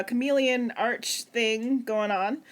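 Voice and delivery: ordinary delivery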